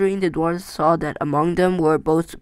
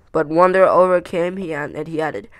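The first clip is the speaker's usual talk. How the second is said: ordinary delivery, nothing marked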